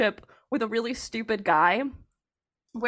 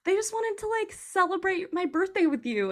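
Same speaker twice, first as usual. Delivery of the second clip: put-on sweet boyfriend voice; 8 semitones higher than usual. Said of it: "he was like".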